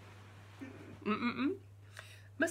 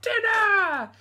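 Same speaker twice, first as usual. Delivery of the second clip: put-on very loud, high baby call; baby demanding; 11 semitones higher, 11 dB louder than usual. Bigger baby shouting for dinner, high and loud. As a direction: bellow for dinner like a huge baby. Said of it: "he said".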